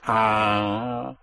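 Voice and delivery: "ah" held out long and level; pushed vowel. Shown as a demonstration. Teacher showing where you push, "ah" held long. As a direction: press on "ah" and sustain it. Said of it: "you push".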